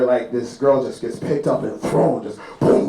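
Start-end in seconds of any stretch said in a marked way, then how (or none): none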